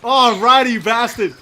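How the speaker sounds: loud laughing triumph; excited yelling. Real, not acted. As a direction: shout with glee. Triumphant yelling after kill.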